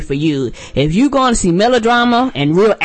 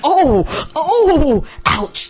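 pained "oh" and "ouch"; 9 semitones higher, much slower than usual. Retold or imitated. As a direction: react as if feeling someone else's blow. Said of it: "I was like".

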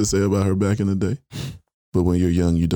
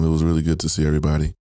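ordinary talk, nothing marked

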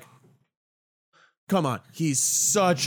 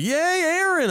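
high, bright fake cheer; mock cheer; 13 semitones higher than usual. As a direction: cheer like a giddy fan. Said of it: "But I'm not gonna be like".

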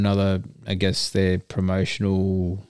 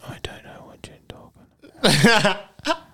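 whisper; 10 semitones higher than usual. Pretend. Coy denial about bodybuilding past.

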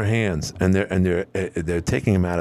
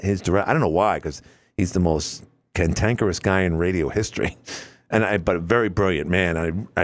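ordinary talk, nothing marked

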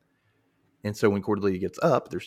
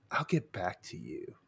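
playing it cool; 3 semitones lower, 10 dB quieter than usual. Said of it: "I'm gonna play it cool".

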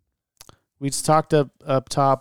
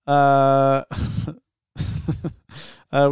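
ordinary talk, nothing marked

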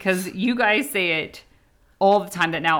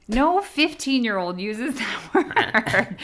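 high, slow, drawn-out teasing objection; mock disbelief; 5 semitones higher, much slower than usual. Mother objecting to Zane's word choice, mock disbelief. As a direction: stretch it out in amused protest. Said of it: "stop and be like".

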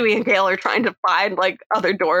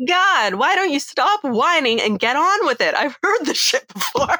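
fed-up mock Sydney; 7 semitones higher than usual. Sydney mocked, bored and dismissive.

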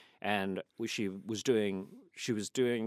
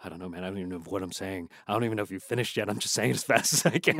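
rapid-fire; 3 semitones lower, much faster than usual. Retold or imitated. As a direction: rattle it off as fast as possible. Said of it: "you say these lines as fast as you possibly can".

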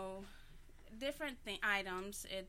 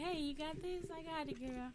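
voicing a girlfriend; 8 semitones higher than usual. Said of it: "they hit me up all the time, like".

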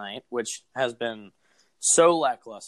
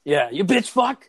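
mock swearing at the TV; 5 semitones higher, 7 dB louder than usual. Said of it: "put two swear words together".

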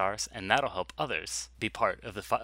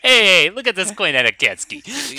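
loud, slow Chicago bro voice; Chicago accent; 10 semitones higher, 10 dB louder, slower than usual. Pretend. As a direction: ham up a Chicago guy. Jokey bro voice, Chicago riff.

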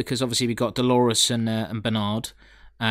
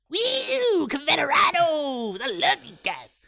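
excited cheer; slower than usual. Retold or imitated. Cheering the Confederados.

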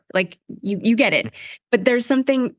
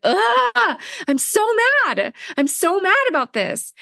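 a mild hint of a childish high whine; childlike tantrum; 10 semitones higher than usual. Shown as a demonstration. Host showing listeners a childlike mad voice. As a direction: sound like a small kid who's mad, lightly done. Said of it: "I sound like a child".

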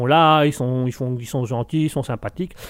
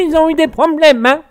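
much higher, louder put-on threat; mock menacing voice; 11 semitones higher, 7 dB louder than usual. Streamer jokingly threatens chat with a hammed-up villain voice.

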